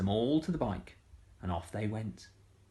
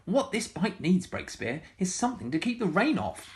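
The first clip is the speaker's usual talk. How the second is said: excited exclamation; 8 semitones higher than usual. Acted. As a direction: announce it brightly to Breakspear. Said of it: "said Missus Armitage".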